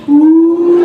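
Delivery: loud teasing ooh; mocking ooh. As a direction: tease like a classmate. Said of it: "What do you think Quincy said?".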